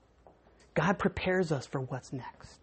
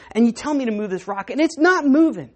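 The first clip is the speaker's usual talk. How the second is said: pleading cry; 4 semitones higher, 8 dB louder than usual. Acted. Man pleading with God.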